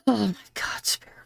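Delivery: barely getting the words out, moved; overwhelmed. Real, not acted. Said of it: "You gonna make me cry".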